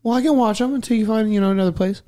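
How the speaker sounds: high mocking impression of the aunt. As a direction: mimic her fake generosity. Mocking aunt in retold story.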